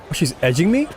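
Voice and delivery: ordinary talk, nothing marked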